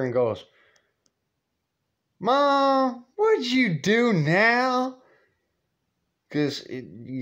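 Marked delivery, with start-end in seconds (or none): 2.2-5.0 high, slow, drawn-out weary question; exasperated son. Retold or imitated. Son to his mother after her latest stunt, weary and high. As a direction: sigh it out at her.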